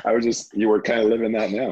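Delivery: giggling while making the joke; laughing. Real, not acted. Host laughs about living through Contagion, talking through laughter.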